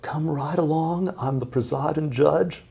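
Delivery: high, slow, breathy Southern drawl. Retold or imitated. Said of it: "in this sort of southern drawl".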